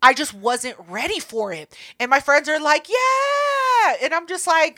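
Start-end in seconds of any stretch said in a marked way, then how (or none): 2.9-3.9 put-on much higher, drawn-out woo-girl yell; cheering shout. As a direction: whoop it like a cheering party crowd. Friends cheering at the bar.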